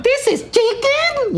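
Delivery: high, slow put-on voice; Taika impression. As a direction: drag it out way up high, mocking. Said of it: "Taika would say some shit like".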